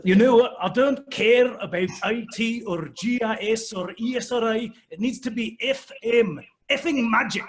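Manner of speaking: high, boisterous Scottish outburst; frustrated Scottish accent. Retold or imitated. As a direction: rant like a fed-up, boisterous Scotsman. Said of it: "super frustrated. He's like".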